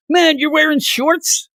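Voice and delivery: drawn-out, high-pitched mock astonishment; high, slow retold exclamation; incredulous stranger. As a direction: imitate a stranger amazed at an outfit. Retelling a stranger's surprised remark.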